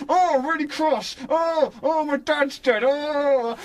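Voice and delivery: sarcastic hammed-up lament; mocking Harry. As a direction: parody flat, overdone grief. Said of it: "So Harry goes".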